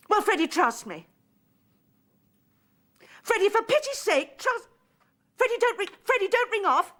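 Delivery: high, loud, desperate begging; cling to him before he hangs up; frantic pleading. Acted. Woman begging Freddie not to ring off, frantic.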